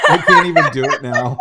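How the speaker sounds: high, loud words bursting through laughs; real hysterical laughter. Real, not acted. Host apologizes for the botched accent, laughing hysterically. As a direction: apologize while helplessly cracking up.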